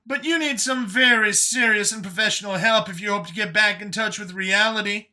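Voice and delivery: high, loud, animated character voice; sarcastic Brian. A narrator's reading. Brian needles Vincent about needing professional help, sarcastic. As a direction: lay on the sarcasm in a high, punchy voice.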